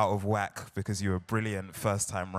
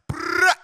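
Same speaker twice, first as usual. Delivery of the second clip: high, loud South London brap call; one South Londoner yelling brrrah; loud brap shout; 10 dB louder than usual. Retold or imitated. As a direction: shout brrrah like a hyped crowd. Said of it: "who'll be like".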